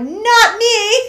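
high, loud emphatic no; mock refusal. Answering Christy, high loud mock refusal. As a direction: flatly refuse with a laugh. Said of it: "I'm like".

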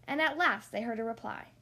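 usual speaking voice

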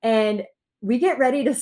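ordinary delivery

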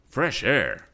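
taken aback, voice jumping up; startled. A narrator's reading. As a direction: repeat it as a startled question. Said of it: "There was a startled note in Mister Swift's voice".

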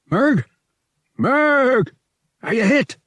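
high, loud, anxious yell; worried yelling. A narrator's reading. Han calling to the wounded Murg, loud anxious yell. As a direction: shout for a hurt friend. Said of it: "he yelled".

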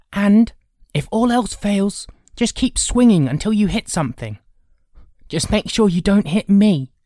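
put-on high, loud boyish voice; jokey pep talk, high and loud; cocky older brother. Grinning brother coaching the younger before a charge, cocky.

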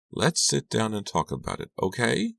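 ordinary delivery, nothing marked

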